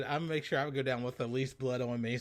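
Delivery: ordinary delivery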